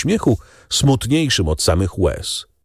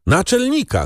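called shout; 12 semitones higher than usual. A narrator's reading. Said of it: "krzyknął".

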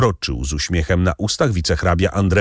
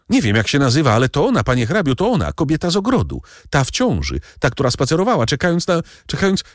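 high, breaking-off frantic babble; panicked agitation; 9 semitones higher, faster than usual. Acted. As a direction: pitch climbs as panic takes over. Bertuccio panics at recognising her.

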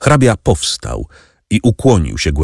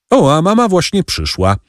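higher, lifted call announcing mother; eager call; 11 semitones higher than usual. A narrator's reading. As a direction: call out to the room that mother has come. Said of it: "zawołał Albert".

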